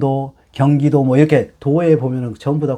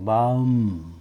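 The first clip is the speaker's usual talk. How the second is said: slow reading; 3 dB quieter, much slower than usual. Shown as a demonstration. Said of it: "지금 요거는 마음 심자예요".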